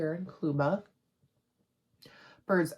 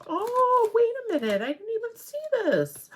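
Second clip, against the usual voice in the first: very high, excited squeal of discovery; real astonishment, pitched way up and louder; delighted surprise; 6 dB louder than usual. Real, not acted. Surprised discovery of an unseen gift.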